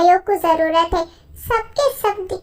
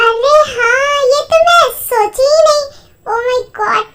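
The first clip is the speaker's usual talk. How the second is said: higher, louder, bright delight; suddenly thrilled by the idea; excited realization; 5 semitones higher, 5 dB louder than usual. Acted. Lisa realizing the sisters can live together, excited.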